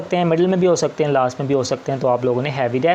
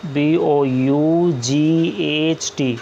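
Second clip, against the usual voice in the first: letters spelled out very slowly one by one; धीरे-धीरे अक्षर बोलना; slow spelling; much slower than usual. Shown as a demonstration. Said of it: "spelling out letters".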